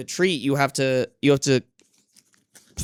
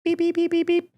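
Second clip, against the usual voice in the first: much higher silly beeping; playful beeps; 14 semitones higher than usual. Real, not acted. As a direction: beep at the cat like a toy. Playful noises at cat.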